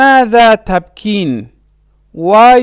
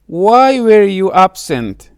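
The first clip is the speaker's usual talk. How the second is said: usual speaking voice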